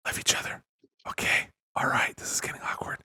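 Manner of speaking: soft breathy ASMR whisper. Pretend. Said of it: "The ending on A S M R".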